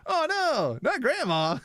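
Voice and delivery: high, shocked exclaiming; mock shocked. Real, not acted. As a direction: gasp it out, high. Reacting to grandma's cold text.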